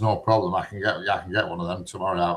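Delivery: ordinary talk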